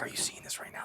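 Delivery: unvoiced, hushed excited whisper. Viewer nudging a friend during a great scene, hushed whisper. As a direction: whisper it to your neighbor in the dark. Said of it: "You're just kinda like".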